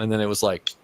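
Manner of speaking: usual speaking voice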